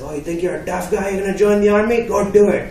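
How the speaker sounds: put-on high-pitched accented dad dare; imitating dad's loud challenge; taunting father. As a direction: goad him as a scoffing dad. Acted father daring his son.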